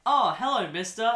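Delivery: a hint of a sweet little girl saying hello; Drew Barrymore voice. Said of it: "Drew Barrymore's like".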